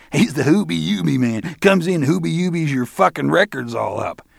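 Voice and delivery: high, loud mocking voice; jeering young guy. One of the guys mocks Roland, high loud jeer. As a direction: crow the joke to your pals.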